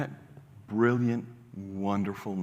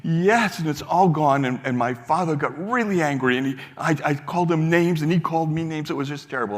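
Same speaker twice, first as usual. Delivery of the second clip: upset retelling; 6 semitones higher, 5 dB louder than usual. Retold or imitated. Young man recounts fight.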